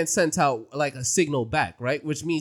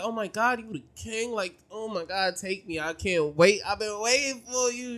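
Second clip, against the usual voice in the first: high-pitched mock swooning; gushing impression; 7 semitones higher than usual. Villager jumping on the supposed king, mock gushing. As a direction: gush like an overexcited admirer, pitched up. Acting out her jumping on him.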